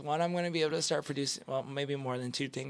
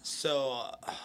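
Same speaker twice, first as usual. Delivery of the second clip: usual speaking voice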